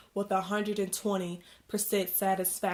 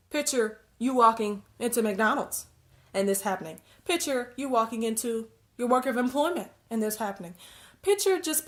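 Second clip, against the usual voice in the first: ordinary delivery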